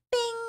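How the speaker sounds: high, quick coin ping; ping it high like a flipped coin; coin-flip bing. Pretend. Streamer handing a viewer a silver coin, high bing. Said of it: "That's the sound of me flipping it".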